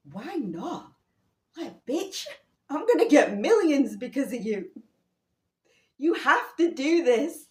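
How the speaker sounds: "Why not?" high and whiny, building to a loud insistent rant; mocking his pushy demand. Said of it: "This is how he says it".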